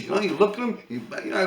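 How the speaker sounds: ordinary talk, nothing marked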